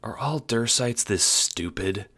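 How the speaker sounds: high, slow, disbelieving question; exasperated Prospidian. A narrator's reading. Prospidian questioning the Dursites' wits, exasperated.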